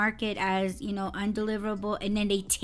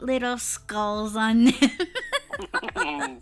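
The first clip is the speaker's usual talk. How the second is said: laughing; much slower than usual. Real, not acted. Delighted about gift.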